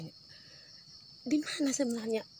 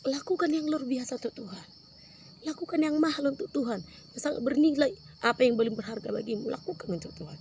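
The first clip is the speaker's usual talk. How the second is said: higher and louder preacher's call; preaching at full force; 5 semitones higher, 5 dB louder than usual. Acted. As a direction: drive the appeal home, some words slowed. Preacher's climax exhortation. (seruan khotbah yang lantang)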